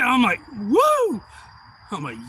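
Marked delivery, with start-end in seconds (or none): 0.5-1.2 high, loud "woo"; celebratory holler; excited whoop. Retold or imitated. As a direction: whoop it like a spectator. Retelling own cheering.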